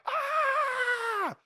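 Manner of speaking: high, thrilled scream at the top of the ride; roller coaster scream. Retold or imitated. Preacher screaming on the coaster's peak with delight. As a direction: shriek with the drop coming. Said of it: "normally we would just start screaming".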